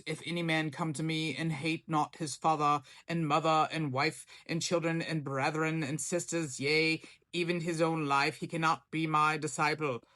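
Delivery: put-on scripture read in an affected old-Bible accent; put-on King James accent. Preacher quoting the King James to viewers, put-on accent. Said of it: "why I did that accent".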